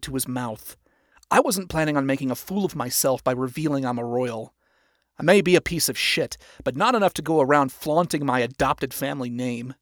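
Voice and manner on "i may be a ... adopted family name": plain reading voice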